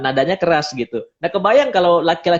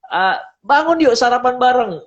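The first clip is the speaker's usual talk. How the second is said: brisk wake-up call; 8 semitones higher than usual. Retold or imitated. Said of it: "misalnya dipanggil".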